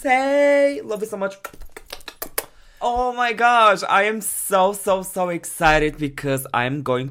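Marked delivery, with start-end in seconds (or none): 0.0-1.4 excited. Real, not acted. Greeting the host excitedly. 2.9-6.0 excited. Real, not acted. Thrilled about reacting.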